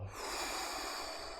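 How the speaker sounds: audible exhale sigh; long breathy sigh. Acted.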